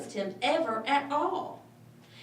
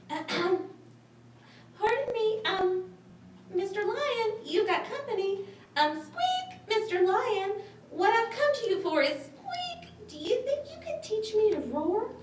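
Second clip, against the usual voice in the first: high, timid mouse voice; squeaky little mouse; 11 semitones higher than usual. Acted. Little mouse nervously asking the lion for help, squeaky. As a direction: ask politely in a tiny squeak.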